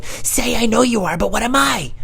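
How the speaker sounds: very high put-on mom voice; insistently feed the comeback in a squeaky voice; high-pitched imitation of mom; mom prompting. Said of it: "my mom's going".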